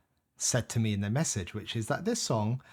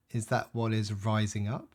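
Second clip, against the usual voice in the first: ordinary delivery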